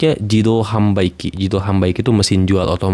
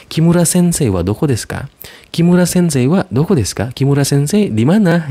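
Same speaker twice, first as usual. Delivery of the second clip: higher, brighter asking voice; asking after the teacher; 8 semitones higher than usual. Shown as a demonstration. Student asking where Kimura sensei is, higher voice. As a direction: play a student looking for the teacher.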